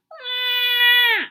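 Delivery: put-on high, loud baby cry; baby wail. Baby crying in the library, loud high wail. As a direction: wail like a newborn.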